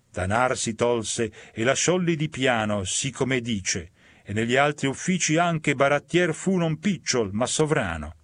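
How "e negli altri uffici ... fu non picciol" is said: ordinary delivery, nothing marked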